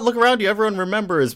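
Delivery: high-pitched, exasperated retold outburst; mock-angry protest, pitched right up; mad outburst. Barry retold raging at Wally, high mock anger. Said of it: "he's just super mad".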